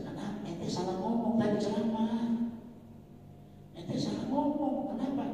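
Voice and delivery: high, mock scolding voice; scolding preacher. Preacher retells telling a speaker he spoke wrongly, scolding. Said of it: "Kata saya".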